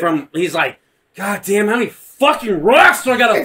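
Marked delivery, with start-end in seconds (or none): 1.1-3.4 higher-pitched comic griping; exaggerated exasperated outburst; mock exasperation. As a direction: rant like a fed-up villain, hammed up. Voicing exasperated Vader throwing rocks.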